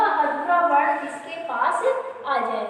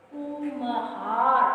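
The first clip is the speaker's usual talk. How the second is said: कुम्हार very slow and even, no force before म्ह; no stress; 3 semitones lower, 4 dB quieter, much slower than usual. Shown as a demonstration. Teacher asking if stress fell, कुम्हार said unstressed. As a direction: draw out कुम्हार without pushing any sound. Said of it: "कहीं जोर पड़ा क्या".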